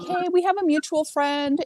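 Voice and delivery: high-pitched imitation of a phone greeting; retold friendly caller. Retold caller's cheery opener to her.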